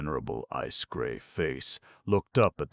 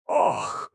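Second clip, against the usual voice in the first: loud disgusted ugh; jay screech; 5 semitones higher, 8 dB louder than usual. Acted. Jay leaving the talk, loud disgusted squawk. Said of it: "screeched the jay".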